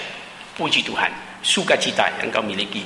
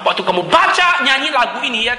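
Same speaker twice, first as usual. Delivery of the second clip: usual speaking voice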